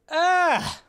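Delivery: high loud yelp of relief; a cry of release; excited yelp. Real, not acted. Excited outburst after finally.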